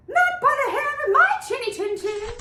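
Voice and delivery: high, fast little pig voice; a frightened little pig squeaking no; high squeaky pig. Acted. First pig refuses the wolf at the door, high and fast.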